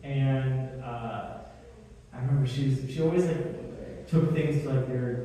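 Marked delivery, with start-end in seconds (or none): none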